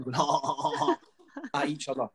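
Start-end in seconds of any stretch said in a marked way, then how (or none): 0.2-1.0 mock sniggering guffaw; lads' stifled cackling, imitated; imitated snorting laughter. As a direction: fake a row of snorted laughs. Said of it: "kinda snorting".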